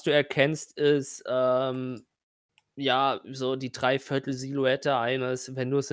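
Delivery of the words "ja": ordinary talk, nothing marked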